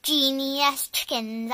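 plain reading voice

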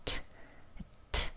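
트 with a burst of air; released t. Shown as a demonstration. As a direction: stop the air, then pop 트. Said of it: "공기 막았다가 터뜨려요".

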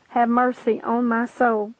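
plain reading voice

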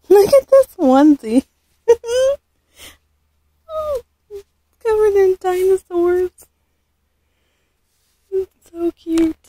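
high, loud squeals of delight; excited squeal. Real, not acted. Unboxing baby clothes for viewers, squealing with delight. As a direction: squeal over the dinosaur onesie.

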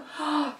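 sharp intake of breath; startled gasp; shocked gasp. Acted. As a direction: gasp at a sudden discovery. Shock at missing tattoo.

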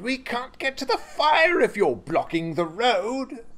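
high, urgent protest; crying out. Acted. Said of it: "he cried".

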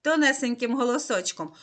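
ordinary talk, nothing marked

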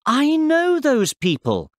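amazed recognition. A narrator's reading. Boy recognises the people on screen, amazed. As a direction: blurt out an astonished discovery.

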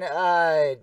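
normal talking voice

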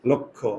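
লক্ষ্য said as "lokkho"; same sound as লক্ষণ; pronunciation demo. Shown as a demonstration. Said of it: "দুইটার উচ্চারণ একই".

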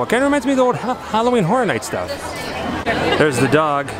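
usual speaking voice

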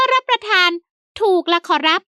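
high, eager fawning servant. A narrator's reading. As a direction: pitch it up as the polite old servant. Servant Haew answers master.

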